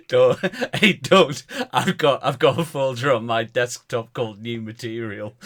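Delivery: loud, high protest through laughter; laughing protest. Real, not acted. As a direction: fend off the tease while cracking up.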